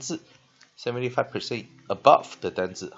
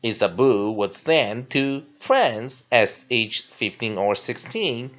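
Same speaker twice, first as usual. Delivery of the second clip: loud, clear classroom text reading; teacher reading the lesson text aloud; 8 dB louder, slower than usual. Acted. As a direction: read the passage out for the class.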